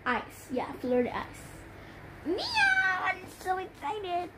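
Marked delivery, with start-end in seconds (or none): 2.3-3.0 high cat meow. Pretend. Cat noise high squeak.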